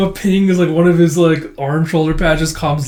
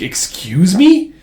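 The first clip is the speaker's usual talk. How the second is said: higher, louder, slow indignant question; affronted disbelief; 5 semitones higher, 6 dB louder, much slower than usual. Retold or imitated. Priest answers Mark's protest, affronted. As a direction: draw it out, acting offended.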